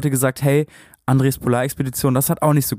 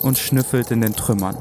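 story narration. Acted. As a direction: read it like a dramatic audiobook. Read dramatic story intro. (ruhige Erzählstimme)

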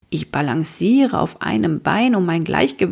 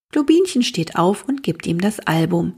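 ordinary delivery, nothing marked

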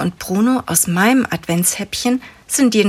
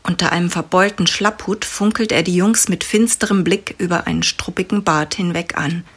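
usual speaking voice

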